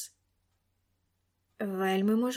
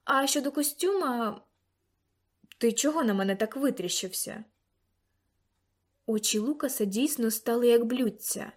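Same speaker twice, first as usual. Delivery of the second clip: usual speaking voice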